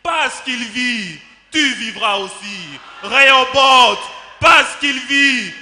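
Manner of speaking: high, loud preacher's declamation, rising to a shout; triumphant climax. Acted. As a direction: build to a shouted proclamation of faith. Climax of the devotional poem. (final triomphal, proclamé à pleine voix)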